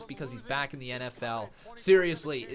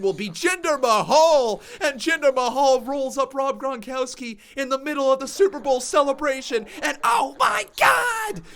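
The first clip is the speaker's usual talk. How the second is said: building to a shout, much higher and louder; mock live commentary; 12 semitones higher, 11 dB louder than usual. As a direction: call the imagined ambush like a frenzied announcer. Imagined Jinder Mahal ambush call.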